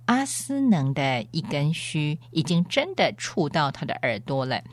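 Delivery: ordinary delivery, nothing marked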